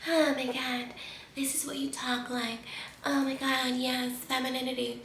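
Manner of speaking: whispery, airy parody of a fake feminine voice; mock breathy girly voice. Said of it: "just sounding like a freaking".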